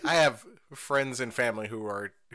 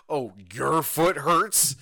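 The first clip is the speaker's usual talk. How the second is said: sarcastic mocking; 4 dB louder, much slower than usual. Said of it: "And I was like".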